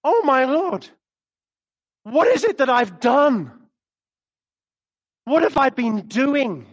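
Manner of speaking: loud, anguished dismay; dismayed believer. Pretend. A convicted believer crying out in loud dismay. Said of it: "There's a sense of".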